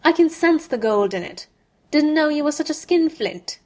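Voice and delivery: mock him as a miser; sneering Malfoy. A narrator's reading. Malfoy taunting Harry as a skinflint, loud sneer.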